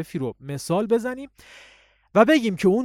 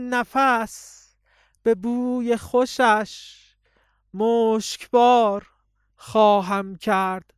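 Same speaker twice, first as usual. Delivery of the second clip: raised, slowed poetic line; higher thin voice; 5 semitones higher, 4 dB louder, much slower than usual. Shown as a demonstration. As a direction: lift the voice thinner and stretch it.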